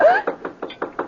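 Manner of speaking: sharp, high startled gasp. Acted. Ma startled by someone at the door, sharp gasp. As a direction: catch your breath at a sudden noise. Sudden knock during tense talk.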